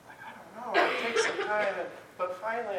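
ordinary delivery

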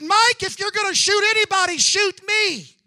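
high, loud defiant cry; loud retold outburst. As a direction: call it out across the room, imitating a reckless friend. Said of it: "it was loud".

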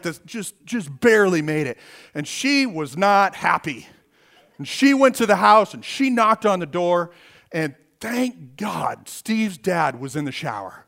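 usual speaking voice

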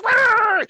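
sudden high, loud imitated dog bark. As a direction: bark it out like an angry little dog. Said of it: "Oki, she's like".

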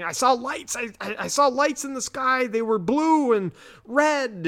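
very high, stammering excitement; frantic. Retold or imitated. Terrified man telling Mulder what he saw, mock frantic. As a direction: blurt it out as a panicked witness.

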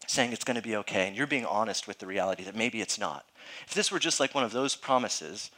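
ordinary talk

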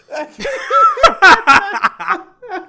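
loud, high burst of laughter; big laugh. Real, not acted. Friend reacts to a thermometer joke with loud laughter. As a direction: crack up at the joke.

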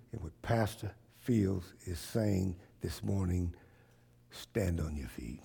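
soft earnest call to stand; quiet earnest preaching. Real, not acted. Quiet sermon close.

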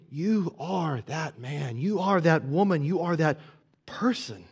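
name their guilt gently, with love. Retold or imitated. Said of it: "Saying it out of love".

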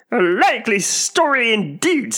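high, loud scornful pigeon; withering, squawked disbelief; deepest contempt. A narrator's reading. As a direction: scoff in a screechy bird voice. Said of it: "in a tone of the deepest contempt".

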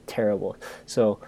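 ordinary delivery

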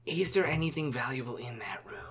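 conspiratorial whisper. Acted. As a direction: ask it under your breath, scheming. Player whispers scheming question.

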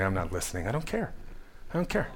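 very soft, dismissive inner voice; quiet refusal. Acted. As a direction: mumble a stubborn thought to yourself. Voicing a listener's inner refusal.

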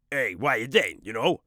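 loud, gravelly, animated character voice; Baz voice. Acted. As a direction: bark it out as rowdy Baz. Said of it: "the voice of Baz is really".

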